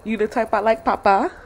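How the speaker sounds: flirt with him, drawing it out; flirty voice. Retold or imitated. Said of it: "I did be like".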